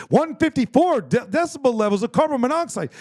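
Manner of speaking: high, loud imitation of a detector alarm; yelling alarm. Man mimics his detector's warning to co-hosts, yelling. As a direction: yell it out like an alarm voice. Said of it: "and it's yelling".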